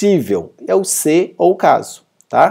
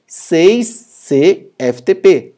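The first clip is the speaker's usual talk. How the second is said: very slow, each letter of "Seis C F T P" apart; very slow spelling out; much slower than usual. Shown as a demonstration. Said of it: "Nós temos agora o seis C F T P". (soletrando "Seis C F T P" bem devagar)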